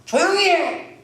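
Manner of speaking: high, sharp acted rebuke; mock scolding, pitched high. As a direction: tell someone off to hush them. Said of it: "사람이 꾸짖었어요".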